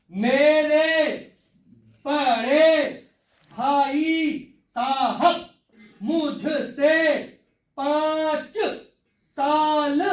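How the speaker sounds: very slow, stretched syllables with gaps; deliberate classroom first reading, every word separated. Shown as a demonstration. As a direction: read it out step by step, drawing out each word. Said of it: "एक एक अक्षर को step कर करके खुद बोलना".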